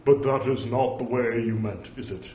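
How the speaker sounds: mock-stern, firmly declaimed rebuke; put-on solemn scolding, no joke in it; humorless pronouncement. Shown as a demonstration. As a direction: overdo the gravity, every word separate. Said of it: "That didn't strike me as".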